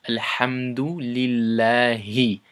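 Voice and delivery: Alhamdulillahi said slowly and carefully; slow recitation demo. Shown as a demonstration.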